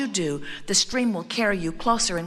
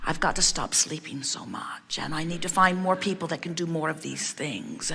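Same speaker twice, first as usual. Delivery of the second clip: low, soft mock self-pity; quiet, downcast litany of complaints, lightly acted; 4 semitones lower, 6 dB quieter than usual. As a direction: imitate someone grumbling under their breath about their workload. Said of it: "she is feeling sorry for herself".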